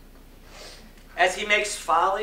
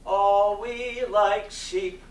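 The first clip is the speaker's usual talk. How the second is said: stretched, swelling and louder to the end; intoned scripture; 3 semitones higher, 10 dB louder, much slower than usual. Acted. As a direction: draw out astray like a chant from the pulpit. Scripture line intoned in sermon.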